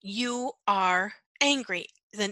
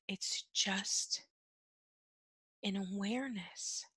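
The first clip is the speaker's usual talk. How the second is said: soft repeat; 12 dB quieter than usual. Shown as a demonstration. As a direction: drop to a hush to let it sink in. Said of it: "I'm gonna say that again".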